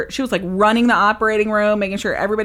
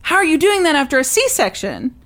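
higher, louder mock amazement; a touch of the nurse's astonishment; amazed nurse; 8 semitones higher, 5 dB louder than usual. Nurse amazed the mother can lift her legs, higher surprised voice. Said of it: "And she's like".

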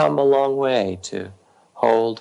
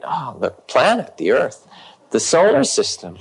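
building from loud to louder, higher; rising rage; 5 semitones higher, 5 dB louder than usual. Retold or imitated. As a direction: let the rage swell out past the planet. Woman's rage growing to cosmic size.

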